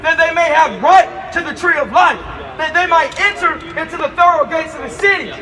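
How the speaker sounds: slow, loud street preaching of the verse. Acted. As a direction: hammer each sin out. Preacher reads Revelation aloud.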